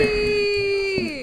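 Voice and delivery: long, high, held-out holler; excited greeting call. Real, not acted. Guest greeting his auntie walking in, high drawn-out call. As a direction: sing out the name in one high stretched note.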